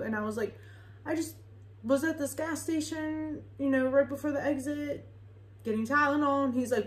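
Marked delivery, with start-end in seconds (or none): 1.1-6.4 high-pitched, jittery retold reply; nervous answer. Driver tells the officer where she was, high and nervous. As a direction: act out an innocent, anxious explanation up high. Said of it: "I was shaky".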